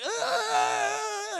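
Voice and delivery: high, long agonized howl; imitated pain wail. Storyteller voicing old man in pain, drawn-out mock wail. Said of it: "but he's like".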